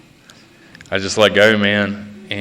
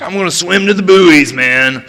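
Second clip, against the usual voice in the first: mock grand vision; 6 semitones higher than usual. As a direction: pitch it up like a man sure the skies will open. Acted grand fantasy before the swim.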